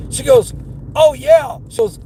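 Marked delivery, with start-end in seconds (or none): 1.0-1.6 high, loud imitation of remembering; retold sudden realization. Customer remembers she ordered diapers, loud mock realization.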